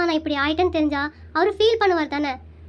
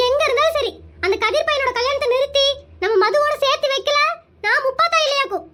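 defiant Muppatha vow; 9 semitones higher than usual. Acted. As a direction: swear it like a challenge. Muppatha vows to stop wedding.

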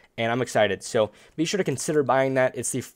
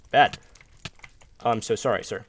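normal talking voice

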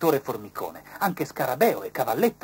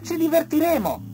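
butterfly's voice; 8 dB louder than usual. A narrator's reading.

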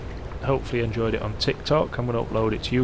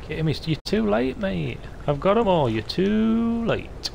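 high, sing-song gloat, stretching out too late; gloating taunt; 9 semitones higher, slower than usual. Real, not acted. Taunting Kitty Mist for arriving too late, high sing-song gloat. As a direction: rub it in to the rival, teasing and high. Taunting rival player beating him.

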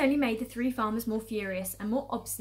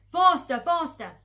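loud, raised repeated command; frenzied shouting; 7 semitones higher, 11 dB louder, slower than usual. Acted. Fat Boggis driving the diggers madly, shouting. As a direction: shout them on, wildly impatient. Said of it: "hopping about like a dervish and shouting".

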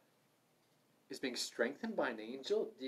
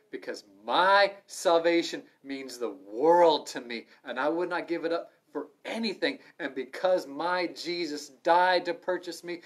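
fiery pulpit emphasis; forceful preaching; 3 semitones higher, 8 dB louder than usual. Acted. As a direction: preach it out hard to the room.

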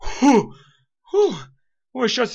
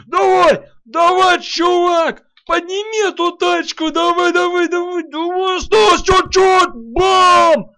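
loud urging; 11 semitones higher, 8 dB louder, slower than usual. Real, not acted. Urging car lift.

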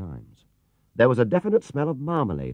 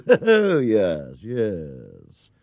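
mock agreement, falling away; sarcastically; 4 dB louder, much slower than usual. A narrator's reading. Gatekeeper mocking the bear's claim, sarcastically. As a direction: humour him with a sneer. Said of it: "said the gatekeeper sarcastically".

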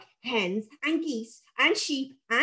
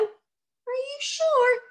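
tiny, high, very slow creature voice; little voice; 14 semitones higher, much slower than usual. Acted. Little sprite asking if it is safe, tiny voice. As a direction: timidly draw out the question. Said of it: "said the little voice".

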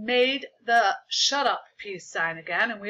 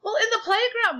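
very high, loud answer; shouted; 14 semitones higher, 6 dB louder than usual. A narrator's reading. Michael answers the teacher's question, shouted. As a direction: blurt it out like a kid. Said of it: "Michael shouted".

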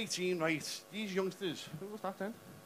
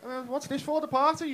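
fake friendly, high; 5 semitones higher, 6 dB louder than usual. Mock friendly dad to Jack.

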